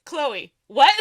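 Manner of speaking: loud, astonished acted question; re-enacted starstruck shock; incredulous outburst. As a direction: burst out in disbelief. Re-enacts shock at author's words.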